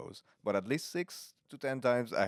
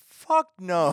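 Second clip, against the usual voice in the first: high, loud mock refusal; emphatic refusal; 10 semitones higher, 11 dB louder than usual. Co-host asked about recording tomorrow, loud refusal. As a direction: blast out a refusal.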